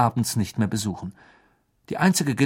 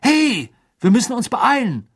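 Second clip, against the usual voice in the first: high, loud call to hurry; startled and urgent; drängend; 11 semitones higher, 5 dB louder than usual. A narrator's reading. Hermine urges them to hurry. (aufgeschreckt und laut drängend)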